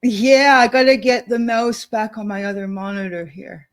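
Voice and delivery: ordinary talk, nothing marked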